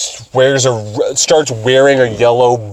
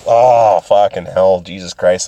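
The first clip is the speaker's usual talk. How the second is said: usual speaking voice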